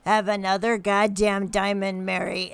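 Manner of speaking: loud, warning scold. Acted. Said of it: "Griselda's walking in and like".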